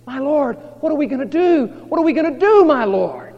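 loud, high-pitched mock alarm; acted panic. Servant running back to his master, acted panic.

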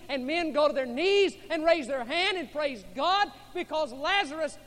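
loud, high impassioned preaching; rising pulpit fervour; preaching at full force. Acted. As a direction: preach it out with fire. Preaching the miracle.

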